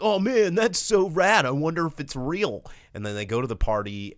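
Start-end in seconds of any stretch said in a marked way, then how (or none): 0.0-2.6 much higher, louder mock excitement; mock thrilled kids. Teens reacting to a spooky video, hammed-up thrill. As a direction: gush like an easily impressed teen. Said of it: "they're like".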